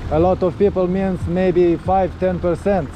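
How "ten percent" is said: usual speaking voice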